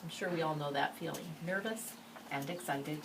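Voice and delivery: ordinary delivery, nothing marked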